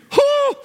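high joyful whoop; a sudden high cheer of praise. Real, not acted. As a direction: let out a celebratory whoop. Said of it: "Watch what happens here".

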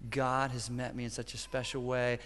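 very soft, low retelling of a wife's words; hushed, tender confiding; wife's quiet sharing. Retold or imitated. Wife telling her husband about her quiet time, softly confiding. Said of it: "then she says to me".

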